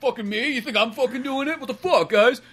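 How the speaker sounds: a hammed-up, pouty Aquaman blaming the team; defensive Aquaman. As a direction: play Aquaman as touchy and accused, building into a complaint. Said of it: "him just being so defensive".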